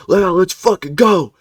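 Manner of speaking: pumped-up yell, high and loud; hype man shout. Retold or imitated. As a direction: hype up the squad. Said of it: "super hype man".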